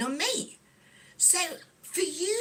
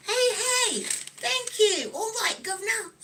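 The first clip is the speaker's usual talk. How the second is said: high-pitched, put-on Cockney; Cockney accent; 7 semitones higher than usual. Shown as a demonstration. Streamer showing off to a viewer who loves British accents, Cockney accent. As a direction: lay on a playful Cockney accent. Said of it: "That's me doing a Cockney accent".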